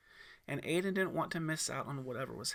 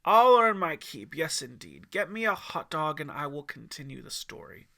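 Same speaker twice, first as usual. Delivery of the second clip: jovial woodsman voice, louder; old mountain man agreeing, jovial; 10 dB louder than usual. A narrator's reading. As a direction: promise it like a hearty old storyteller.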